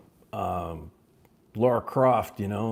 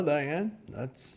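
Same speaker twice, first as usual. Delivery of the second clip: normal talking voice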